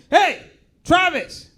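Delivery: put-on high, loud parent calling a kid; yelling parent. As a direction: holler the kid's name across the yard. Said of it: "American parents yelling at their kids".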